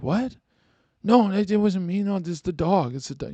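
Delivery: put-on high-pitched protesting girlfriend; girlfriend in denial. Drunk girlfriend blames the dog, high-pitched denial. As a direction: protest high and flustered, blaming someone else. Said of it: "she's gonna be like".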